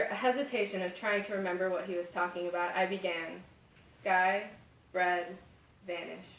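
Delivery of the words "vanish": vanish drawn out softly like a magic word; magical emphasis on vanish. Shown as a demonstration. A child telling her father the last word, vanish, made magical. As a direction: say vanish as if it makes something disappear. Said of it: "I emphasize the word vanish, making it sound magical".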